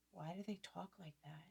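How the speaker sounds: very soft, imitated boys' whisper; confidential, barely audible question; hushed aside. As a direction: ask it under your breath. Voices her boys' puzzled aside.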